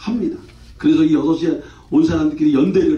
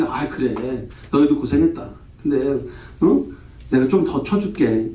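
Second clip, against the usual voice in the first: lower, slowing, gently placating voice; conciliatory; 5 semitones lower, 3 dB quieter than usual. Acted. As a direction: soothe disgruntled workers, easing into a slower pace. Imagined kindly owner soothing workers.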